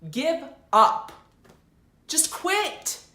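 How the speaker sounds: loud, high-pitched outburst; shouted rant. Acted. Fake substitute teacher lectures the class, shouting in disgust. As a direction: yell each phrase, stretching out the last.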